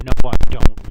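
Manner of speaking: loud, sudden outraged denial; shouting. Acted. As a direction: blurt it out in horror. Sudden outburst against spam.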